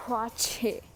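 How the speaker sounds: ordinary delivery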